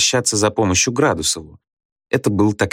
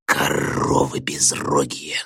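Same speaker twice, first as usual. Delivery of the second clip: low, slow, menacing growl; Gradusov's vicious growl; 5 semitones lower, 4 dB quieter, slower than usual. A narrator's reading. Pupil insults the girls who exposed him, growling viciously. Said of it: "злобно прорычал Градусов девицам".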